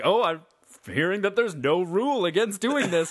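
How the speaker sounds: lightly voiced play-by-play announcer; sports commentator. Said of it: "It's like".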